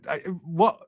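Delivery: normal talking voice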